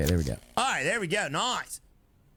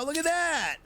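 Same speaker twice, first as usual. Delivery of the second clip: excited; 11 semitones higher than usual. Real, not acted. Pleased moment in game.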